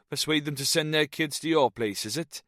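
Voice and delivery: teasing Welsh lilt; Welsh accent. A narrator's reading. Jones teases Snow in a Welsh accent. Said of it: "The Welshman's accent".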